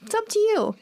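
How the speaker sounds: high-pitched, falling on YOU; deferring reply. Shown as a demonstration. Answering someone who asks Chinese food or pizza, deferring. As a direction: hand the choice over in a high, lilting voice. Example reply leaving the choice.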